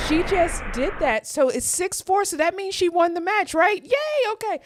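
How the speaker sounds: high, bright victory yelps; real joy bubbling over; excited. Real, not acted. Streamer celebrating Coco's win to viewers, excited and high. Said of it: "you guys will hear my voice go up".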